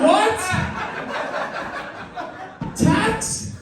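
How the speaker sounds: high, startled outbursts; incredulous. Real, not acted. Comic reacting to the friend's tax answer, high and incredulous. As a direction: shout it in disbelief.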